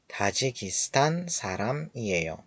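slow, soft "تاجیکستان سرم ایه یا" falling on "ایه یا"; falling statement tune. Shown as a demonstration. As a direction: state it slowly, "ایه یا" going down. («ایه یا» خبری، آرام و رو به پایین)

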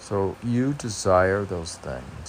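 ordinary talk

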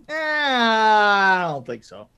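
long, dragged-out "eh" of disbelief; drawn-out doubt. Acted. Viewer reacting to teeny tiny jets, slow mock doubt. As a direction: stretch it out, skeptical and slow.

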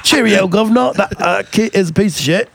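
high, hammed-up mock-British voice; clumsy British caricature; shitty British accent. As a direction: put on a badly faked cockney-style British accent. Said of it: "my shitty ass accent".

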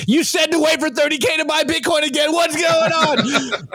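high, strained mock yell of a football player; voicing the angry player. Host voicing a meme's player yelling at his coach, high mock outrage. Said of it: "here's Nick's take".

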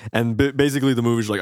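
ordinary delivery

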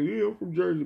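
high mock hometown boast; mocking imitation. Imitating a rapper claiming Jersey down south, mocking.

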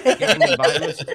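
loud, high words through laughter; helpless amused laughing delivery. Real, not acted. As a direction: crack up while repeating the joke. Joking repeat while both laugh.

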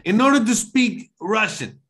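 high-pitched mock Russian; overdone Russian impression; terrible Russian accent. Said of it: "No, it's terrible".

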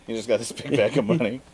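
usual speaking voice

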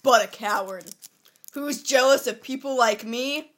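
loud dramatic poem recital; dramatic poem reading. Acted. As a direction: declaim it like a wounded teen. Reads poem aloud.

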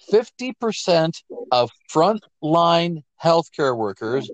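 slow, word-by-word emphasis; slow, deliberate. Real, not acted. Host addressing Canada so the poll sinks in, slow and deliberate. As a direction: spell it out slowly so it sinks in. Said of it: "I'm saying that slowly so it sinks in".

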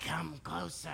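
low, hushed fake grandma; wolf as grandma. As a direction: beckon softly and low, posing as grandma. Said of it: "And she's like".

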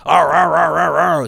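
rapid repeated growly "arr"; mimicked crazy flailing noise. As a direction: imitate wild dance moves with noises. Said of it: "type of thing in the video".

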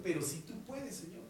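soft, hesitant half-hearted plea; a mild take on a doubting believer; mimicking a doubtful prayer. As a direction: imitate someone who prays without faith. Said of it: "clásico de la oración que muchos dicen".

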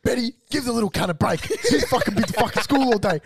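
loud, hammed-up domestic rant; acted angry scolding. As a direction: berate Betty as a comic impression. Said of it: "No, no, it's like this".

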